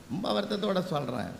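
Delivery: soft, falling, regretful; sorrowful. Real, not acted. Speaker lamenting to audience about uncuddled children, sorrowful. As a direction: drop the voice in real regret.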